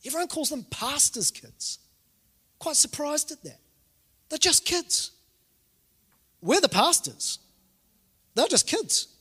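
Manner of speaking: high-pitched, mock-offended defence; retold parental indignation, high; indignant protest. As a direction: protest on the kids' behalf, pitch up. Indignant about pastors' kids label.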